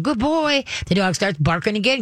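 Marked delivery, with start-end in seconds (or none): 0.0-0.6 put-on high, bright-eyed "Good boy" to a dog; excited praise. Trainer imitating an owner, excitedly praising the dog. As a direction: gush over the dog the way owners do. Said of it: "but if you start going".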